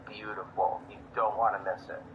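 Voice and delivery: loud, low and showy to be overheard; loud announcement. Acted. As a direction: talk it up loudly for strangers to hear. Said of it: "I'll loudly say".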